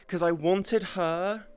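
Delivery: ordinary talk, nothing marked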